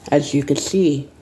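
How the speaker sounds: normal talking voice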